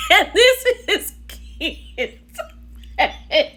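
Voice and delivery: high-pitched, drawn out through laughter; slow, squeaky words dissolving into real laughter; laughing. Real, not acted. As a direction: break up laughing while talking. Cracks up at a reaction face.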